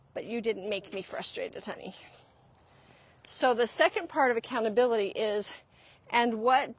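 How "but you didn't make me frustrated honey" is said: soft, low parent voice; gentle and hushed, letting the child off the hook; tender reassurance. Acted. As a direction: softly soothe a child.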